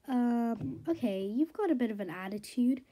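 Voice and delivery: normal talking voice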